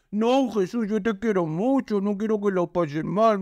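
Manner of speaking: a hint of a syrupy, pleading Peter; lightly voiced sweet-talking disciple; mock tender plea. Preacher voicing Peter pleading tenderly with Jesus, mock tender. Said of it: "viene Pedro tierno a decirte".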